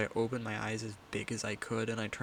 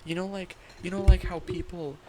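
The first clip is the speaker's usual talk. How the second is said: high joking voice; 7 semitones higher, 6 dB louder than usual. Pretend. Joking, raised bro voice.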